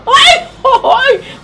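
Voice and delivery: high delighted shriek of "ay, ay"; a giddy, blushing squeal; excited squeal. Real, not acted. Flattered squeal at compliment.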